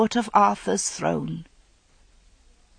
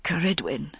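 whisper; 3 semitones lower, 6 dB quieter than usual. A narrator's reading. Invoking goddess in shock.

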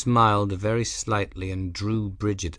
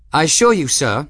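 high, loud imperious noblewoman; furious commanding lady's voice; grand hollywood dame; 8 semitones higher, 7 dB louder than usual. Acted. Gwen's imperious voice.

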